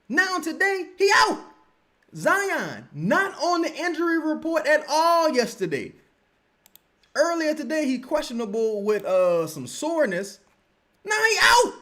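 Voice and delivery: high, loud, disbelieving outburst; exasperated rant. Real, not acted. Venting to stream chat about surprise injuries, exasperated.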